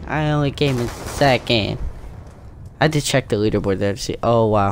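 ordinary delivery

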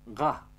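"Ga" with a throat-made G; guttural "Ga"; throaty G. Shown as a demonstration. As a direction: say "Ga" from where throat meets palate, not a Romanian G. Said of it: "Este un fel de G".

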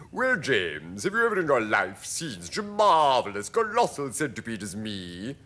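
swaggering, emphatic centipede voice; grandly self-admiring creature; boastful centipede. A narrator's reading. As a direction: show off as a vain centipede. Boastful centipede speaks to James.

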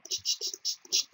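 soft unvoiced ch ch to lure it; whispered shushing lure. Real, not acted. Gamer luring the dragon toward him, whispered ch sounds. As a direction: hush it closer like calling an animal.

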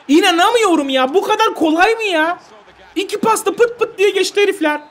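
high, loud disbelieving cry; shocked disbelief. Real, not acted. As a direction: cry out at the conceded goal. Yenilen gole şaşkın tepki. (yenilen gole şaşkın isyan)

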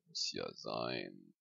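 low, slow snooty aside; haughty drawl. Acted. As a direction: sigh it like a snob.

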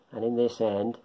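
usual speaking voice

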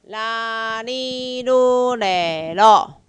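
loud, drawn-out kana syllables; syllable drill. Shown as a demonstration. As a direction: call each syllable out clearly for the class. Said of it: "来，". (带读假名，每个音拉长念)

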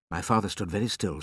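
plain reading voice

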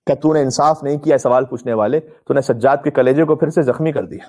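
ordinary delivery

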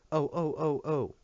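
high, fluttering run of ohs; flustered alarm. A narrator's reading. Women rush down to the hurt boy, flustered.

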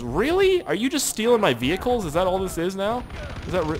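high, incredulous complaint starting on a squeaked "Really?"; indignant disbelief. Real, not acted. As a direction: protest it in exasperated disbelief. Protests vehicle being stolen.

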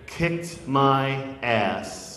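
very slow, word by word; drawn-out punchline. Shown as a demonstration. Poet tells the audience of a second beating, slow and deliberate. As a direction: draw each word right out.

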